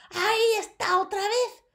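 high, loud witch voice; high witch sniffing out a child; shouting witch. A narrator's reading. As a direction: screech like an excited witch. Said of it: "gritó la primera bruja".

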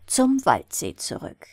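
plain reading voice

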